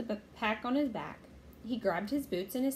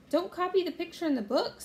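high-pitched, insistent little sister; eager and bossy, pitched up; urging; 9 semitones higher, 4 dB louder than usual. A narrator's reading. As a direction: urge him in a raised, lively voice. Annie urging her brother.

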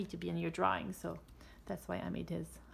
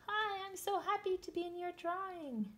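high, bright cartoon cheetah voice; 10 semitones higher than usual. Illustrator voicing her cheetah to the kids, high and happy. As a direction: squeak it out like a happy little cub. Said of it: "he's saying".

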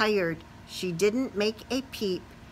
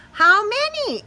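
mama voice; 12 semitones higher, 6 dB louder than usual. Acted. Mama asks spider.

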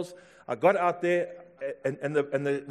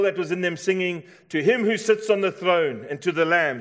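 raised, driving sermon delivery; full-force preaching; 5 semitones higher, 7 dB louder than usual. Real, not acted. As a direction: proclaim it to the whole congregation.

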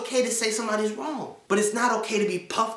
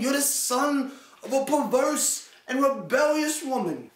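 higher, hammed-up formal tirade; mock stiff angry dad; 6 semitones higher than usual. Mocking an angry dad who speaks in stilted King James English, higher. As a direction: ham up an old-fashioned insult. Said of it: "who gets mad that you know and starts saying".

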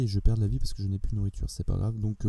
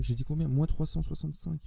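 ordinary delivery

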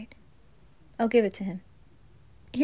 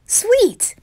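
high, loud cheer; excited; 11 semitones higher, 6 dB louder than usual. Acted. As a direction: burst out with joy. Thrilled they can dance.

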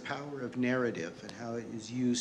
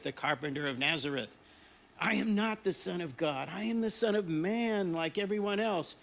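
indignant denial; 8 semitones higher, 6 dB louder than usual. Acted. Jesus furiously denies the preacher.

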